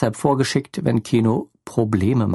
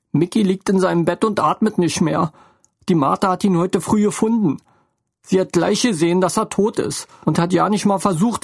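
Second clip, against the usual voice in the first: higher, tearful motherly voice; upset motherly woman; 6 semitones higher than usual. A narrator's reading. Lene breaks the news to Theo, distraught. Lene's voice in the story.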